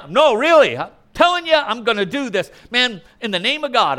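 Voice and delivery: high, loud, pushy insisting as Gehazi; preacher playing Gehazi, loud and insistent; insistent shout. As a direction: swear to it loudly, overselling the lie. Preacher acts Gehazi swearing.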